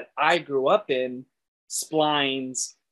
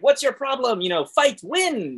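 lightly acted scolding, higher and louder; mock exasperated pep talk, pitched up; E from The Incredibles; 8 semitones higher, 4 dB louder than usual. E telling crying Helen to fight, mock scolding.

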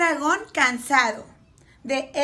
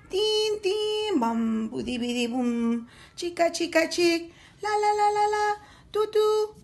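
mimicked band instruments; 4 semitones higher than usual. A narrator's reading. Musical dragons play a song, sung instrument noises.

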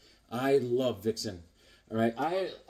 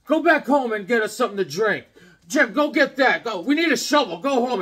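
put-on bossy older kids; 7 semitones higher, 7 dB louder than usual. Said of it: "they was like".

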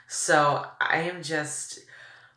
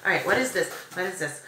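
normal talking voice

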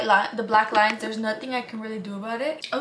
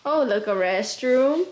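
ordinary talk, nothing marked

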